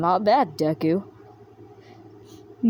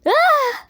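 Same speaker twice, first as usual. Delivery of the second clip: much higher, loud sudden shriek; shocked high shriek; 7 dB louder than usual. Acted. Shocked scream.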